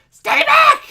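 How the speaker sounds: piercingly high, full-force shriek; terrified scream. Acted. Girl screams at the woman reaching for her, terrified scream. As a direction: scream it in great fear, very loud. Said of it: "Stacy reacted in great fear".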